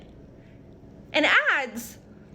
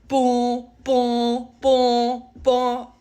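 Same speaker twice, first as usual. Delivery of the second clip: mocking boom; 5 dB louder, much slower than usual. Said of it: "Karina goes".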